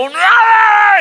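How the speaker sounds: high, loud mock babble of shouting; imitating a noisy family's racket; loud family yelling. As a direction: shout nonsense over everyone. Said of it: "they get very loud".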